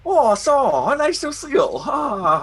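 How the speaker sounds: very high, loud mock-Chinese accent. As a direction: switch into a squeaky, hammed-up Chinese accent. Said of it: "stereotypical making fun of Chinese people voice".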